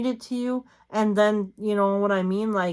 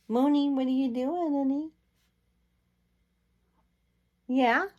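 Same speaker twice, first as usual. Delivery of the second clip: pet talk; 7 semitones higher than usual. Real, not acted. Owner cooing up high at her cat Mooney, baby talk.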